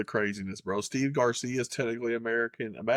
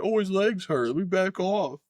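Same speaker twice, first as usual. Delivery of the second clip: higher-pitched mock complaint; mocking quote; 8 semitones higher than usual. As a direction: mimic him with a raised, mocking pitch. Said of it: "This motherfucker's like".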